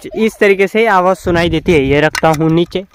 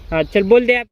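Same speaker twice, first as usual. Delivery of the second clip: usual speaking voice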